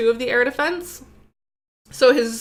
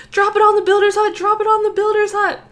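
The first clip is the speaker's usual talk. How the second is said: high, fast, loud urgent yell; re-enacted screaming; 9 semitones higher, 5 dB louder, faster than usual. Player yelling at his iPad during a live attack, screaming. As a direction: yell frantic orders at a screen. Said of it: "I was just screaming at the, at my iPad".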